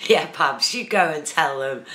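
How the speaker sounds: loud, cheering encouragement; excited cheer. Real, not acted. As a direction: egg him on with a loud cheer. Cheering on a chat viewer.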